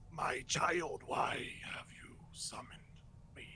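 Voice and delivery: slow, whispered rasp from a summoned demon prince; guttural dark lord. Acted. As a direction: draw each word out in a voiceless growl. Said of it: "you hear a, a guttural, low voice".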